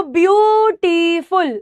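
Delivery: slow ब्यूटीफुल in three parts, ब्यू-टी-फुल; teacher stretching out each sound of ब्यूटीफुल; syllable by syllable. Shown as a demonstration. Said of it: "तो बोलकर देखो".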